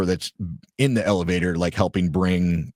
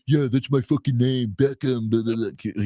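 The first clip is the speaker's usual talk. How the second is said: retold jeering comeback, slightly raised pitch; making fun; 3 semitones higher than usual. Drunk fan tries to make fun of the lady, jeering. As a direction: imitate a mocking retort that fades into filler. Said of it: "trying to make fun of her".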